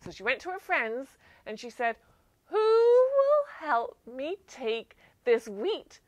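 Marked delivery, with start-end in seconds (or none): 2.4-6.0 high, loud little hen. Acted. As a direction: ask brightly as the hen. Hen asks friends in story.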